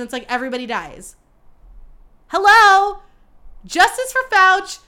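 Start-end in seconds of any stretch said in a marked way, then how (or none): 2.3-3.0 loud, high exasperated hello; indignant hello. Acted. Host to everyone ignoring Fauci, indignant. 3.6-4.8 loud, high protest slogan; rallying cry. Acted. Host rallying listeners for Fauci, indignant rallying cry.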